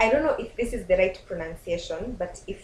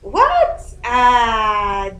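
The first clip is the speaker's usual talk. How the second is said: incredulous; 2 semitones higher, 10 dB louder than usual. Real, not acted. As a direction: exclaim in shrieked bafflement at the question. Stumped by a long word.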